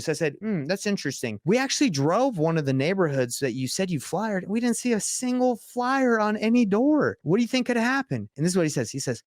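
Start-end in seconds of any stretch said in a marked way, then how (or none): 0.4-8.3 feigned puzzlement. Retold or imitated. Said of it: "give him the opportunity to just admit it".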